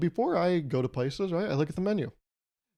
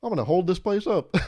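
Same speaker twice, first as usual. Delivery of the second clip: high, loud hammed-up holdup yell; comic stick-up shouting at the whole café; mock robber shout; 8 semitones higher, 5 dB louder than usual. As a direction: bark orders like a robber. Acting out a robbery.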